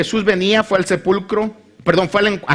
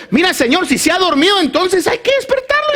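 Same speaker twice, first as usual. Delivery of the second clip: high-pitched, pleading argument; trying to persuade; 13 semitones higher, 4 dB louder than usual. Acted. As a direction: act out eagerly talking someone round. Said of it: "tratando de convencerlo".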